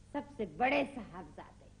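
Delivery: normal talking voice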